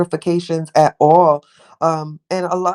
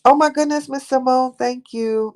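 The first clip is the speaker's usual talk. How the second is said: 1 delighted exclamation; 9 semitones higher than usual. Real, not acted. Delighted greeting to a viewer.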